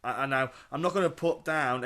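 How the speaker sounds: usual speaking voice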